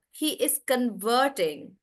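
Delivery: "converting" slow, stressed on VERT; verb stress. Shown as a demonstration. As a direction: lean on the middle syllable of "converting". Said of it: "that's the verb".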